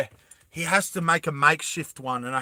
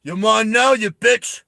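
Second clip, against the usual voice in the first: mock hero taunt; 5 semitones higher, 6 dB louder than usual. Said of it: "and then Hammy's like".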